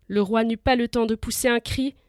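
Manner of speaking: ordinary delivery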